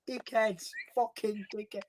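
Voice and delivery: high put-on Hull accent; Hull accent character. Character swearing at his mates, put-on Hull accent. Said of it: "I can't do that accent".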